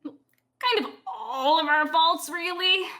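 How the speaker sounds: slow sheepish. Acted. Slow teasing confession.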